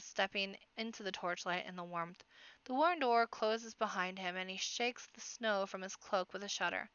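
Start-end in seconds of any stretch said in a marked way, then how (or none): none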